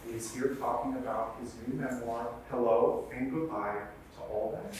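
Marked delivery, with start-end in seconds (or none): none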